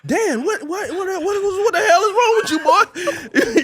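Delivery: high, loud panicked dad voice, laughing through it; hammed-up alarmed father; frantic dad. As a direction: play the freaked-out father yelling. Dad finding son on floor.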